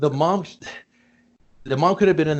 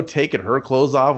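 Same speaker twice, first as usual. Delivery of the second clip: ordinary delivery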